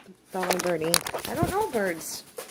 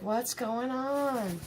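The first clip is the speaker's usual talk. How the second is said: slow, softer, dazed ferret voice; voicing the ferret; 9 dB quieter, much slower than usual. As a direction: drag it out like a puzzled ferret. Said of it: "Jack's hanging out like".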